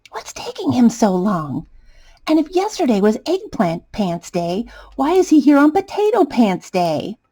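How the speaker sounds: ordinary delivery, nothing marked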